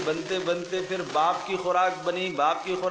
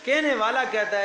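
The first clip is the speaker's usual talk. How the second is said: ordinary delivery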